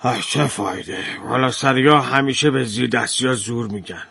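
low, soft resigned sigh; weary sighing farmer. Acted. Farmer sighs to the mender about the rich, low and soft. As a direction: sigh it out, defeated. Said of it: "کشاورز آهی کشید".

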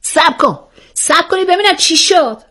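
high, startled demand to hold on; sudden outburst. A narrator's reading. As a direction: jump in sharply, pitch shooting up. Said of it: "مثل یک ترقه از جا پرید". (با هیجان و صدای زیر)

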